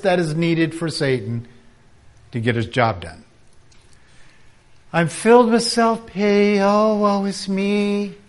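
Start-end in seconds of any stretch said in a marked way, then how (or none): 4.9-8.2 mock self-pity. Preacher mocking a self-pitying friend, higher and whiny. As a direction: ham up the poor-me moan.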